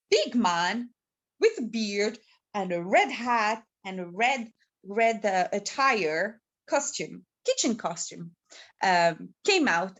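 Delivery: usual speaking voice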